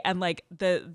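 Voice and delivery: ordinary talk, nothing marked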